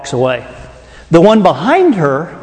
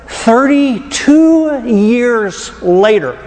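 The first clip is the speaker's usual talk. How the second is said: very slow, drawn out and higher; dramatic reveal; 5 semitones higher, much slower than usual. Real, not acted. Preacher revealing the time gap to his congregation, dramatic and slow. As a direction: stretch each word for the big reveal.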